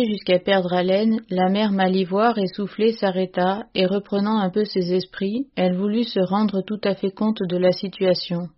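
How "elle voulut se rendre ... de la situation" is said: ordinary delivery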